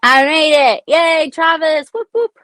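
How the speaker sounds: loud, high, drawn-out yay; celebratory shout rising into high whoops; excited cheer. Real, not acted. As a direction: whoop to welcome a friend who just arrived. Cheering a viewer's arrival.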